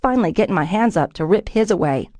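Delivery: plain reading voice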